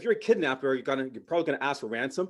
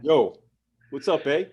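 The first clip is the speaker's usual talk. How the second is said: polite Canadian gangster; 6 semitones higher, 4 dB louder than usual. Pretend. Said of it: "our drive-bys are polite".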